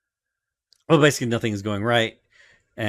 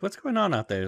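puzzled Nami; 10 semitones higher than usual. Retold or imitated. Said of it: "Nami's like".

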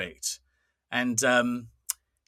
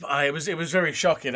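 ordinary delivery

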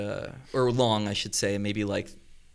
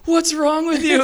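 high, loud imitation of scolding friends; incredulous mock yell; 8 dB louder than usual. Friends scolding him over the payment, incredulous mock yell. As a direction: yell it high, mimicking their disbelief. Retelling everybody's mocking reaction.

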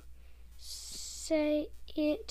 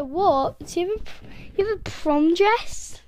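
loud, high, rising disbelief; shocked disbelief; 3 semitones higher, 10 dB louder than usual. Retold or imitated. Rereading friend's shocked text, loud high disbelief.

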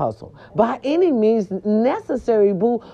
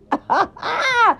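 high laugh; 10 semitones higher than usual. Real, not acted. Laughing at Jada news.

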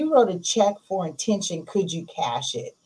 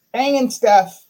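louder, hyped repeat; excited shout; 6 semitones higher, 9 dB louder than usual. Real, not acted. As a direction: call it out with excitement. Loud repeat of quiet take.

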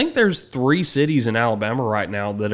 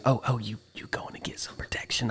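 nervous whisper; 3 semitones lower, 13 dB quieter than usual. Retold or imitated. Neighbour asking if he'll get protection, whispered. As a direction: whisper it hopefully. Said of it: "He goes".

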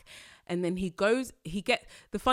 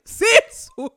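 much higher and louder, laughing through "Since when?"; laughing disbelief; 16 dB louder than usual. Retold or imitated. Host repeats her reaction to his claim, laughing loudly in disbelief. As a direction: crack up while repeating the question. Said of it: "I was like".

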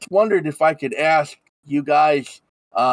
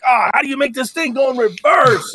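higher, laughing fumble; flustered Haggis; 10 semitones higher, 3 dB louder than usual. Acted. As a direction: struggle with the controls aloud. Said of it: "like, hitting stuff".

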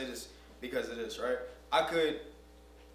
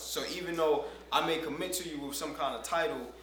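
normal talking voice

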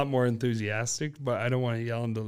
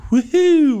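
high loud mocking woohoo; imitating Anthony; 11 dB louder than usual. Brother Anthony flicking the kids, loud high woohoo. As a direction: yelp it like a big brother teasing. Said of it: "Anthony going".

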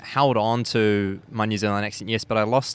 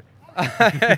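happy laugh; 10 semitones higher, 9 dB louder than usual. Real, not acted. Greeting someone arriving.

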